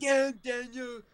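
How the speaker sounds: high, loud, drawn-out meme quote; slow, exaggerated funny voice; Damn Daniel meme. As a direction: imitate the viral clip in a funny high voice. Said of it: "you gotta do funny voice".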